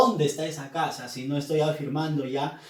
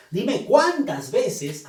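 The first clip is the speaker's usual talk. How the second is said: "cuántas" slow, stretched and louder; emphatic cuántas; 5 semitones higher, 6 dB louder, much slower than usual. Shown as a demonstration. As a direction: hit "cuántas" as the question word. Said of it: "cuántas es enfática". ("cuántas" enfático, lento y fuerte)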